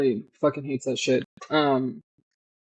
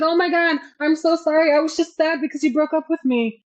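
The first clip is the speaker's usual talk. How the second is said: much higher, gushing fake sorry; mock whiny apology; 11 semitones higher than usual. Retelling the girl caught at the penthouse, high mock apology. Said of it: "And she's like".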